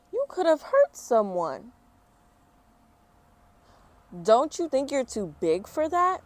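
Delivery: high, loud scolding; scolding teacher. Acted. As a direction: tell her off sharply.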